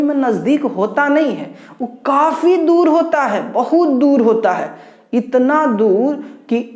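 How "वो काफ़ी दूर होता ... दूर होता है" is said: दूर pushed up high and loud; emphatic stress on दूर. Shown as a demonstration. Teacher tells students how far stars really are, emphatic stress on दूर. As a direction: lean hard on दूर, building awe.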